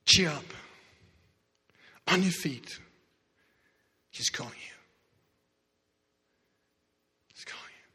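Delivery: drops to a whisper; fading urging. Acted. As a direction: tell him softly. Crowd urging the beggar.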